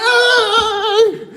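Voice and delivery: high, loud, drawn-out holler; imitated yell. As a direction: holler like the panicked cellmate. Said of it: "As soon as I heard him start yelling".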